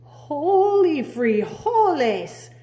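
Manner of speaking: slow, loud, high exclamation; cried out. Acted. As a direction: shout it as you thrust the sword. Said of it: "cried Scapito".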